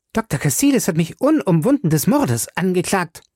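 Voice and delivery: Betty protesting the murder charge; indignant outcry. A narrator's reading. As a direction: cry out in offended anger. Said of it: "rief Betty erbost".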